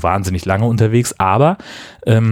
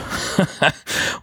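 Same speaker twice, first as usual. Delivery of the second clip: brief unvoiced chuckle; quick breathy laugh; short laugh; 9 semitones higher, 6 dB quieter than usual. Real, not acted. Laughs at drinking several bottles.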